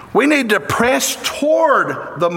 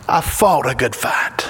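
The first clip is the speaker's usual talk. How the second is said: whispered, reverent and low; hushed whisper; 8 semitones lower than usual. Retold or imitated. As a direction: breathe the words like last words. Paul's last words quoted.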